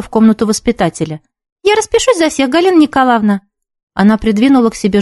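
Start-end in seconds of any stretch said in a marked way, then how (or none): 1.6-3.4 sweet schoolgirl voice. A narrator's reading. Girl trying to trick teacher.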